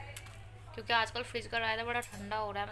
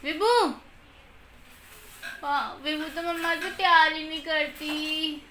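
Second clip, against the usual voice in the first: mock sulk; 6 semitones higher, 9 dB louder, slower than usual. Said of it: "acting कर करके".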